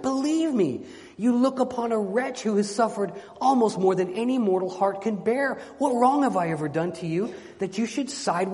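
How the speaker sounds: high, impassioned storybook begging; desperate plea. Acted. Enchanted knight begging his guests for rescue, desperate plea. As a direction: sustain a high, urgent plea that peaks on key phrases.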